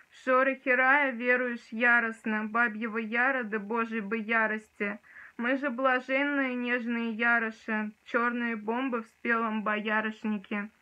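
reciting a poem with dramatic force; dramatic poetry declamation. Shown as a demonstration. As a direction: declaim it like on stage. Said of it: "сейчас тоже его прочитаю".

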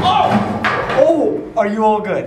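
high, loud startled check-in; alarmed. Real, not acted. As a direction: shout in alarm. Something falls on friend.

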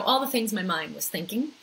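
normal talking voice

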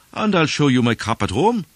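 high, loud insistence; protesting stranger. A narrator's reading.

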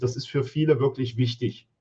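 ordinary delivery

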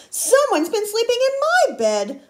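high, loud indignant outcry; Mama Bear crying out. A narrator's reading. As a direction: cry out like a shocked mother bear. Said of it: "Mama Bear cried".

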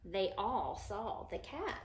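slow storybook read, easing down at the end; reading to children. Acted. Teacher reading the book to her listening children, storybook voice. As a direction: read it to a group of small kids.

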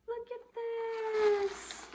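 very high, drawn-out squeal; long high-pitched gush of delight; thrilled squeal. Real, not acted. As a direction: squeal it slowly, showing off a treasure. Shows off find in delight.

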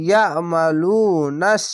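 ইয়ামালুনাস slowly, with a long "loo"; one-alif elongation. Shown as a demonstration. Teacher showing learners the rule, ইয়ামালুনাস recited slowly with long "loo". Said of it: "পেশের পাশে অসাকিন এক আলিফ টেনে পড়বেন".

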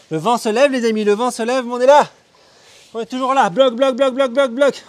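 high, loud rallying cry; shouting. Real, not acted. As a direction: pump the class up, full voice. Coach pumping up class.

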